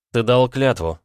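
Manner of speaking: ordinary delivery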